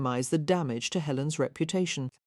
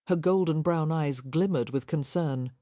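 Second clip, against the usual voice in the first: ordinary delivery, nothing marked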